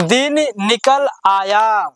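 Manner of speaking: दिन निकल आया read slowly with a clear pause after each word; slow, careful reading. Shown as a demonstration. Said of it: "चलिए पढ़ते हैं".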